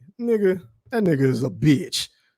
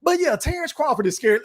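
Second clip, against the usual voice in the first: sarcastic agreement; 7 semitones higher than usual. Real, not acted. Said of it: "let's just roll with that motherfucking narrative".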